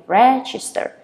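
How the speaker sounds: REGISTER with REG punched; first-syllable stress. Shown as a demonstration. Teacher showing where the stress falls, first-syllable stress. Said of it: "you stress on the first syllable".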